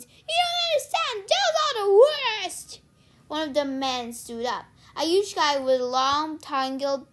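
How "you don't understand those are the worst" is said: very high, loud screech; screamed protest. A narrator's reading. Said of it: "Sears screamed".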